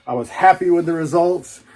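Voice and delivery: ordinary talk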